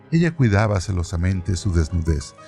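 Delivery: ordinary delivery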